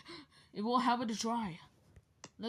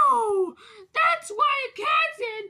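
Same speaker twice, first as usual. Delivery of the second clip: high, loud sobbing wail; crying shout; 14 semitones higher, 9 dB louder than usual. Pretend. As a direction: scream it out while crying. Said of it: "I'm not changing the voice".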